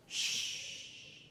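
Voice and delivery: long hissed shh; drawn-out shush. Real, not acted. Shushing book fans, long and unvoiced. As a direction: hush them with one long breath. Hushing the complainers.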